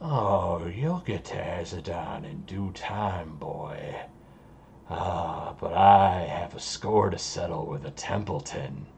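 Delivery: low, soft villain voice with a smirk; smirking villain Kuru. Acted. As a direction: toy quietly with the captive, pitched low. Smirks and says.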